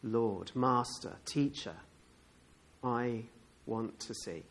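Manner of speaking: low, soft, slow plea; hushed, halting answer; humble request. Acted. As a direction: say it quietly, pausing between phrases. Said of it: "And he says".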